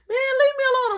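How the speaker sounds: high, loud brush-off; snapping back at the classmate talking smack; irritated shout. Retold or imitated. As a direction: yell it to shut him up.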